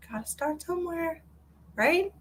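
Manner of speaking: high and soft, gently cheering her on; soft encouragement. Real, not acted. Encouraging a struggling viewer, softly and gently. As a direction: coax her kindly.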